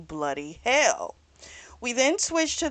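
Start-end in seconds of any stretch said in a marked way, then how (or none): 0.0-1.1 a mild, slow British "Bloody hell"; lightly voiced British man cursing; understated British accent. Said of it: "she hears a British accent saying".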